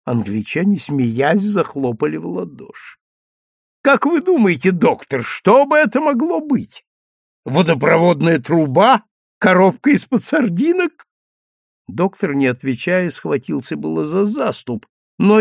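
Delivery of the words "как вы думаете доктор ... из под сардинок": high, teasing jeers; mocking. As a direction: taunt him with high mocking questions. Englishmen mocking the doctor.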